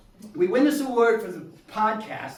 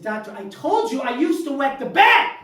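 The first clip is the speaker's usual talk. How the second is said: indignant shout; 6 semitones higher, 7 dB louder than usual. Retold or imitated. Patient complaining to doctor.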